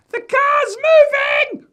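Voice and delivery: put-on very high, stretched-out child's scream; shrieking little boy raising the alarm. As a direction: scream it slowly at full pitch. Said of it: "he's screaming".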